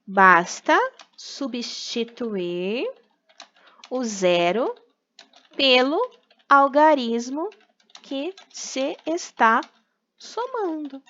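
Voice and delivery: slow, phrase by phrase with pauses; slow dictation. Shown as a demonstration. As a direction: dictate it slowly, pausing between chunks. Teacher dictating the rule slowly.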